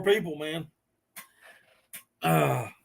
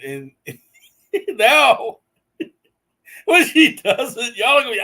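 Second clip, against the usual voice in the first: high, loud words breaking into real laughter; laughing hard; 5 semitones higher, 7 dB louder than usual. Real, not acted. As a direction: struggle to talk through a big laugh. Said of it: "I'm laughing so hard".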